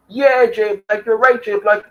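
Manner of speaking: high sing-song yes-man voice; butter him up in a high mocking voice; mocking fawning fans. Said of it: "Those same people were".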